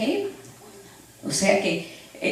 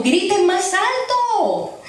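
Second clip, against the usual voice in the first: slow, higher, laughing jeer; mocking taunt; 5 semitones higher than usual. Elijah mocking the prophets of Baal, slow laughing jeer. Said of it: "Elías se burlaba de ellos diciendo".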